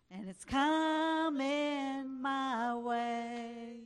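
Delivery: normal talking voice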